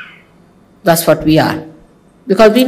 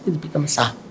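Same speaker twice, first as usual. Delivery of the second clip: 1 muttered aside; 4 semitones lower, 12 dB quieter, much faster than usual. Retold or imitated. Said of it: "they say".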